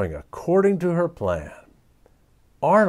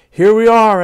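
loud, higher announcement; announcing; 9 semitones higher, 12 dB louder than usual. A narrator's reading. Boy announcing to classmates they have arrived, loud. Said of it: "announced Arnold".

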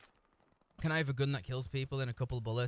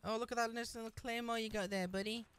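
mock cooing voice; 5 semitones higher, 4 dB quieter than usual.

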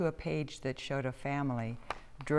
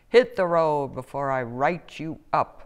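loud, gruff police chief command; barked order; 9 dB louder than usual. A narrator's reading. Police chief threatens crab with a ticket, loud bark. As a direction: bark it like a cop moving someone on. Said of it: "he barked at the crab".

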